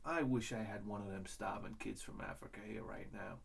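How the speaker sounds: quiet, low aside to himself; saying it to himself. Retold or imitated. Comic guy at dinner musing to himself, quiet aside. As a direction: murmur it like a private thought. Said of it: "say to myself".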